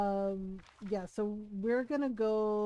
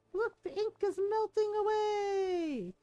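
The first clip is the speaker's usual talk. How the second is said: slow, higher storybook read-aloud of game text; slow, higher read-aloud of a game line; game dialogue read; 8 semitones higher, slower than usual. Acted. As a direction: announce it like the game's narrator.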